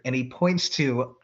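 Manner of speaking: ordinary delivery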